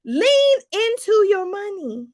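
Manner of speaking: slow, punchy coaching line; emphatic urging. Shown as a demonstration. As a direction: press Lean into your money home. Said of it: "slow coaching emphasis".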